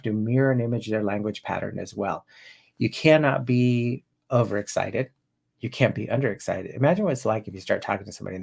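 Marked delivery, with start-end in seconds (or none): none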